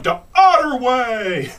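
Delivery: put-on high, slow kid voice; kid in background. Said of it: "this kid in the background going".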